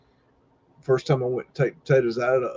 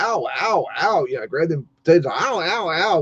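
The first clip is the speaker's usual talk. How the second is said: higher, mild imitated yelps of pain; mock ow; 5 semitones higher than usual. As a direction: yelp as if grabbing hot potatoes. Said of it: "it's like".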